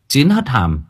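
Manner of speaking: ordinary delivery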